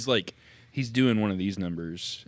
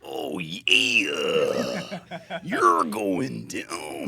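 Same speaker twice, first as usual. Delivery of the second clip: wrestling promo; 3 semitones lower, 8 dB louder, much slower than usual. Acted. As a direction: growl it like a hyped wrestler, drawn out. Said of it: "your best wrestler voice".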